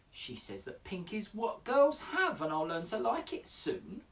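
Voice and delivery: lightly voiced little girl, rising to high protests; hammed-up childish complaint in verse; eight-year-old girl. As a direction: grumble like a put-upon child. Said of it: "You have to imagine I'm an eight-year-old girl".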